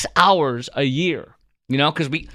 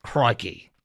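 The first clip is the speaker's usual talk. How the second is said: "Crikey" said as /kʰɹɑki/, a quieter, tentative mock Australian; a hesitant, low-key go at the Australian accent; soft Australian try; 3 semitones lower, 3 dB quieter than usual. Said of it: "yeah, accent".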